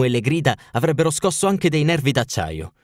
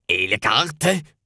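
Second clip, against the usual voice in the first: very slow, harsh royal demand; harsh king; much slower than usual. Acted. King demanding the papers from the housekeeper, slow and harsh. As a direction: bite out the question. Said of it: "chiese il re con voce aspra".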